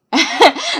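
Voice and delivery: short high laugh. Real, not acted. Vlogger laughing at her own repeated phrase, brief laugh. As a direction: laugh at yourself.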